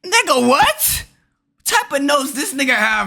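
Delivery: high-pitched disbelief; incredulous. Real, not acted. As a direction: pitch the questions way up, baffled. Incredulous reaction to intruder's words.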